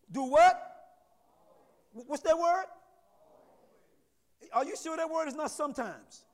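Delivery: loud, high call-and-response preaching. Real, not acted. Preacher prompting the congregation for the word, preaching loudly. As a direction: throw each question out to the church.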